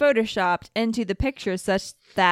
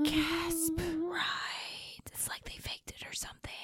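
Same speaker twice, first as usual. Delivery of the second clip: hushed conspiracy whisper; 8 semitones higher, 15 dB quieter than usual. Acted. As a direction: whisper it like a dark secret. Mock shock over faked photos.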